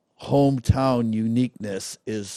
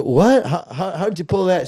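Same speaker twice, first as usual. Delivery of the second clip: higher, louder mock-astonished What?; retold astonishment; 7 semitones higher, 5 dB louder than usual. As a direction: replay your own amazed disbelief. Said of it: "I go".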